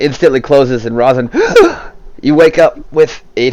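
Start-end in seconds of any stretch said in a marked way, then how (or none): 1.3-2.2 sudden high gasp for air; reviving gasp. Acted. Rosin waking after healing, sudden sharp gasp. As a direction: gasp as if jolted back to life. Narrator voices fallen fighter reviving.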